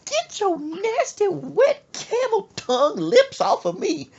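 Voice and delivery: high, loud screech, drawn out; mock outraged mother. Mother telling off her son, mock outraged screech. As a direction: screech like a disgusted mama.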